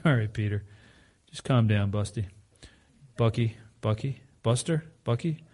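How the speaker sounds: calm Peter down with a fond smile in the voice; a soft chuckle while soothing a friend; kind, chuckling Jesus. Retold or imitated. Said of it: "And Jesus kindly says to him".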